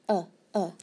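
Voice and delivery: "uh, uh" as two short separate vowels; isolated "uh", pause, "uh"; short U sound. Shown as a demonstration. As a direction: say "uh" twice, cut short. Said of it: "The short U".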